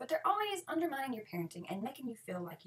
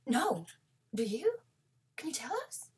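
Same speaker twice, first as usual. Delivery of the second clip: playing dumb; 5 dB louder, slower than usual. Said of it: "play dumb".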